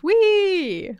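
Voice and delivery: high gleeful squeal; gleeful whee. Pretend. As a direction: cheer like a kid at a demolition. Excited about destroying things.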